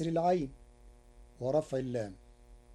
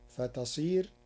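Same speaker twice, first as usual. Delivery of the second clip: ordinary delivery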